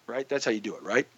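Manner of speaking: usual speaking voice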